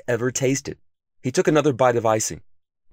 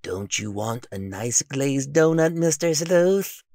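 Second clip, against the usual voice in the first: slow, coaxing, rising temptation; tempting donuts; 4 semitones higher, slower than usual. A narrator's reading. Imagined donuts calling to the hungry detective, coaxing. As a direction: lure him in like tempting treats. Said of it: "He imagined them calling to him".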